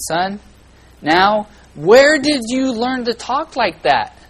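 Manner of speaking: a hammed-up shocked father questioning his boy; stern dad. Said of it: "so I said".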